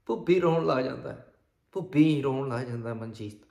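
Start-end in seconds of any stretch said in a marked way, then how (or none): none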